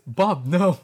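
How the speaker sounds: hammed-up high, loud protest; alarmed gasp. A narrator's reading. Alexa pleading with Bob, hammed-up alarm. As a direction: melodramatic cry to stop him. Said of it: "Alexa gasped".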